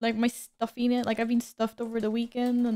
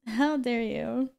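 slow mock outrage; much slower than usual. Repeated slowly for effect.

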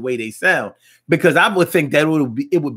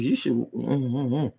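ordinary delivery

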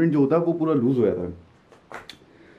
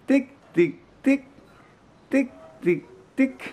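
high playful ticking sounds; tick tick clicks; 9 semitones higher than usual. Pretend. Father making high playful ticking noises to amuse baby.

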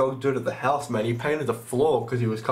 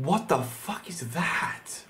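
whisper; 4 semitones higher, 5 dB quieter, slower than usual. Real, not acted. Creepy image on screen.

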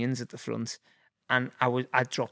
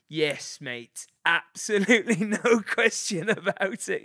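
higher, laughing, mock-keen yes; eager agreement; 7 semitones higher, 4 dB louder, slower than usual. Athlete asked to switch nationality, eagerly agreeing in retelling. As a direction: jump at the offer, pitch climbing into a laugh.

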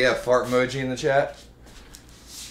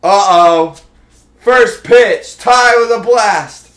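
loud, high, thrilled yell; excited yelling; 6 semitones higher, 8 dB louder, slower than usual. Real, not acted. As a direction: shout it as the ball flies. Home run on first pitch.

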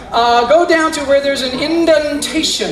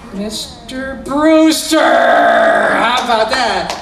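milk the reveal, drawing each word out; drawn-out triumphant shout; much slower than usual. Acted.

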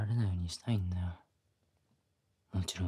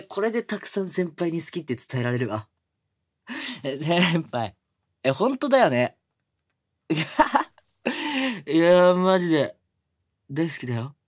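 higher, louder giddy joy; bursting with happiness, laughing; overjoyed; 7 semitones higher, 10 dB louder than usual. Acted. Junior shouting his joy at senpai's yes.

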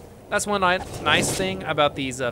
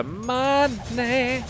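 higher, sing-song, slow money; squeaky delighted money; 10 semitones higher, much slower than usual. Pretend. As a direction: sing money high and gleeful. Delighted about extra cash.

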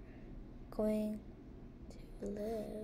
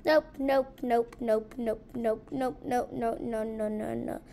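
loud rapid nope nope fading down; panicked nopes; 8 dB louder than usual. Real, not acted. Gamer backing away from monster, loud panicked nopes. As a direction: refuse it in a rising panic then fade. Panicked refusal at scary thing.

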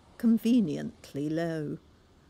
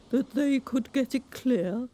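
high, louder storybook walrus; 7 semitones higher, 3 dB louder than usual. A narrator's reading. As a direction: proclaim it like a pompous walrus. Walrus speaks.

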